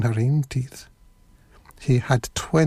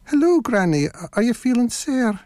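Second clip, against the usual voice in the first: put-on high-pitched little girl voice; 9 semitones higher than usual. A narrator's reading. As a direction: greet granny as a wee girl. Girl greets her granny.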